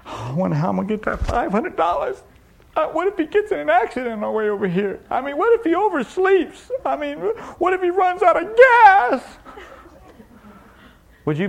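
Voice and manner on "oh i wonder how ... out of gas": fretting that climbs high into a wail; mock worrying. Preacher mimics someone awake all night over promised money, mock worrying. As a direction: work yourself up into hammed-up panic. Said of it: "you think you're gonna be up all night?".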